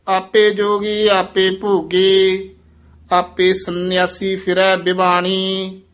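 measured chanting recitation of Gurbani; scripture recitation. Shown as a demonstration. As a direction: recite each phrase slowly and evenly.

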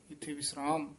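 usual speaking voice

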